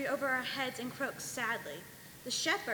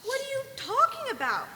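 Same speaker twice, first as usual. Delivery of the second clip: surprised; 12 semitones higher, 4 dB louder than usual. Acted. Real Marian confused by accusation.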